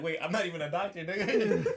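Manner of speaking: mock doctor. Friend voicing the fake doctor.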